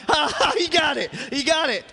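high, loud, laughing cry; laughing excitedly. Real, not acted. Preacher laughing with a worshipper who got it. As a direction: laugh it out in delight. Laughing over someone getting it.

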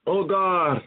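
ordinary talk, nothing marked

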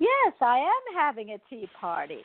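slow, genteel Southern belle invitation; breathy vintage purr. Pretend. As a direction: play the grand tea party hostess. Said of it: "I would be like".

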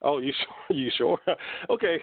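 nervous giggling; nervous open mic poet. Real, not acted. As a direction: laugh off the stage fright. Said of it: "I'm laughing because".

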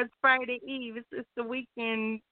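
normal talking voice

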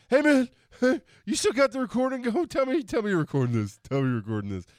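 put-on high, loud, laughing drunk plea; drunken excitement. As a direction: beg them loudly, drunk and giddy. Drunk yelling at podcast hosts.

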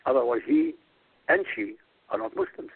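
ordinary delivery

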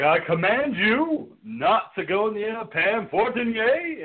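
slow, high, grandiose order; mock commanding master. Host as Blackula ordering his minions, mock commanding. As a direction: proclaim it slowly, hammed-up.

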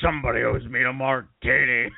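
gravelly Rip Torn growl; Rip Torn impression. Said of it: "quoting Rip Torn line".

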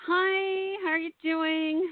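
high, bright nervous hello; excited greeting. Real, not acted.

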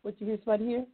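ordinary delivery

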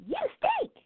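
put-on very high child blurting it out; little girl to classmate, shrill high. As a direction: squeal it like a small girl. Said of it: "a little girl said to another little boy".

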